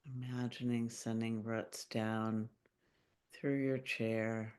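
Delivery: calm meditation voice with long pauses; unhurried, gently guiding listeners into their bodies; guided meditation. Acted. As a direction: lead a grounding visualization, phrase by phrase, leaving long silences. Leads a grounding meditation.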